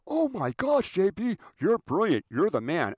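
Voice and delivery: high, loud mock amazement; hammed-up squealing flattery; gushing yes-man. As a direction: rave as if it were genius. Yes-men gush at producer.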